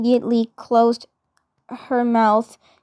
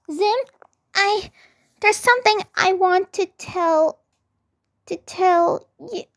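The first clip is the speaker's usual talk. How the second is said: put-on nervous girl voice, halting; flustered stammer; 7 semitones higher than usual. A narrator's reading. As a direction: stammer it out shyly.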